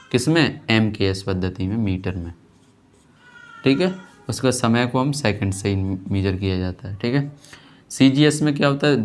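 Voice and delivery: usual speaking voice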